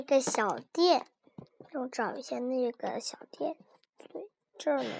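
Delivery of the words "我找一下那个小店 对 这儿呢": whispered aside. Real, not acted. Searching the page, aside.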